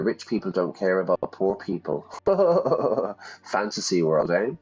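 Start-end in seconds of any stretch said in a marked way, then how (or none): 2.1-3.3 short high laugh. Real, not acted. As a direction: burst out laughing. Laughs at radical game text.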